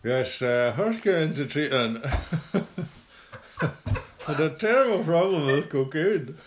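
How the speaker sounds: high squeaky voice, lips barely moving; squeaky ventriloquist dummy. Pretend. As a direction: talk through a frozen grin. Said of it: "What's wrong with his mouth?".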